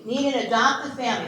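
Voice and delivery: ordinary talk, nothing marked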